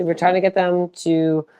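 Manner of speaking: ordinary talk, nothing marked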